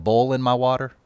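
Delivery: Southern "boiling" said like "bowling", the oi flattened to an o; Southern-accented "boiling my water" said as "bowling my water". Shown as a demonstration. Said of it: "people boil their water now rather than bowling".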